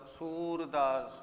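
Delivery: सूरदास said slowly, long uu. Shown as a demonstration. Teacher to class, slow correct सूरदास with long uu. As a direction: stretch the uu of सूरदास. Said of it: "सूरदास शब्द होता है".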